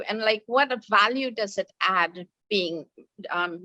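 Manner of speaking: normal talking voice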